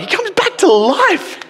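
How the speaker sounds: very high, slow, laughing disbelief; incredulous squeal. Retold or imitated. Stunned reader hearing of the resurrection, squealing in disbelief. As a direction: squeak it out in total amazement.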